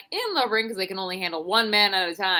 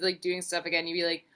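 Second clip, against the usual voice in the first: normal talking voice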